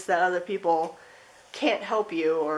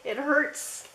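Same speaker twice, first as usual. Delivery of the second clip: higher, aching repeat; mild pained cry; 7 semitones higher than usual. Acted. Reading a card's message to viewers, a higher aching repeat. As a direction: let the ache lift the voice higher. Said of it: "This message here says".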